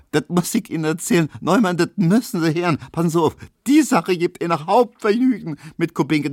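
high and fast, barely holding in laughter; gleeful, excited. Acted. Tesch to his roommates, eager to share the joke, high and gleeful. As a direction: rattle it off, bursting to tell. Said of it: "schrie Herr Tesch und hielt sich die Seiten vor Lachen".

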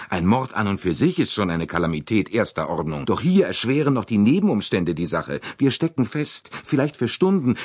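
rising alarm as he lists the problems; agitated railway director. Acted. Bouc explaining the crisis excitedly.

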